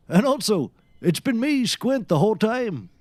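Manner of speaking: high, loud unmasking; bursting out with the big reveal; dramatic reveal. Acted. Revealing himself to his friend, high and loud.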